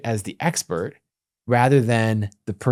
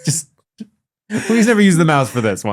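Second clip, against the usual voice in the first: pitched-up pleading coworker; a hint of begging, a bit louder; pleading developer friend voice; 5 semitones higher, 3 dB louder than usual. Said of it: "he'd be like".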